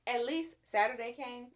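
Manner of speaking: ordinary delivery, nothing marked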